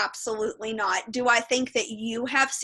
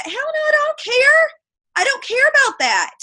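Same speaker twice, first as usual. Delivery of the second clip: much higher and louder, scoffing; scoffing dismissal; 14 semitones higher, 7 dB louder than usual. Real, not acted. Asked about The Bachelorette, loud scoffing dismissal. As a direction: wave the whole idea away.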